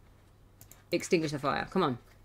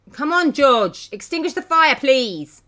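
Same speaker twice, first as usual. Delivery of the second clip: loud, high pleading order; exasperated urging; 7 semitones higher, 9 dB louder than usual. Real, not acted. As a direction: shout at a useless helper to act. Urging character to fight fire.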